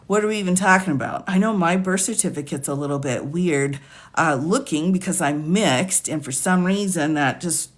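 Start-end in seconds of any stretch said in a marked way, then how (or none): none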